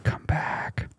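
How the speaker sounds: soft unvoiced whisper luring you back; a sly tempting whisper. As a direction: breathe it like a tempting inner voice. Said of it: "that whisper right there".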